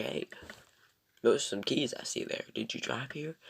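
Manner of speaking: lightly put-on southern accent; a hint of imitated southern twang. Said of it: "a southern accent".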